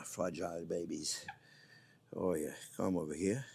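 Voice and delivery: low hushed coaxing; doting coo. Real, not acted. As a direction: sweet-talk the eggs out of the pot. Coaxing eggs out of the water.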